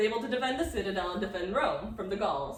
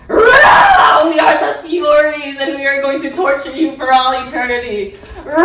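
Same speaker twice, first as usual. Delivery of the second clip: roaring Furies; 5 semitones higher, 19 dB louder than usual. Acted.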